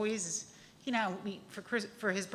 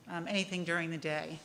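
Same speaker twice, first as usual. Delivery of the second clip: ordinary talk, nothing marked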